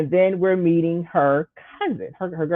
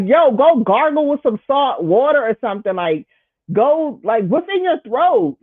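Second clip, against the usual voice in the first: exasperated scolding; 7 semitones higher than usual. Retold or imitated. Telling him off.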